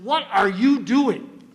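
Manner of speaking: retold scolding question, each word drawn out; incredulous daughter. Retold daughter catching him in the act.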